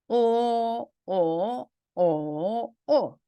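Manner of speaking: 오 level, rising, dipping, then sharply falling; four-tone drill. Shown as a demonstration. Teacher letting students hear four tones on 오, level, rising, dipping, falling. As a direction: chant 오 through high level, rise, dip, drop. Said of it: "성조 사 성을 붙여서 한번 읽어볼게요".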